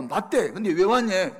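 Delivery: loud, high comic retelling; puzzled exclaiming. Retold or imitated. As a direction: act out oblivious surprise at full voice. Acts out the pastor's oblivious puzzlement. (영문을 모르겠다는 듯 크고 높게 되묻는 말투)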